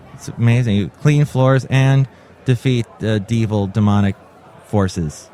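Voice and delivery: ordinary delivery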